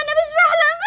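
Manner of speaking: high-pitched, breaking into crying; tearful protest. Acted. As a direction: insist through rising tears. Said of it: "اهدي ما تعيطيش".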